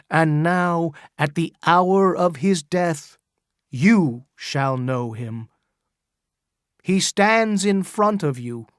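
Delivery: crying out to the crowd. Acted. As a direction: proclaim it to the whole crowd. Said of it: "he cried".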